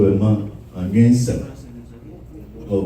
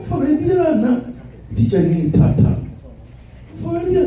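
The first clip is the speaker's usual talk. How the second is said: high mock plea. Child voice pleading uncle.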